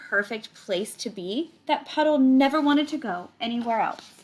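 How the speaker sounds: reading a picture book aloud to the class; story reading. Acted. Teacher reading the book to her class, storytelling voice. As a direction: tell it like a storybook for kids.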